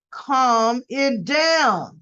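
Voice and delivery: very slow, firm order; slow command. Retold or imitated. Detective telling the arguing men to settle, slow and firm. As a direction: lay out each word with authority.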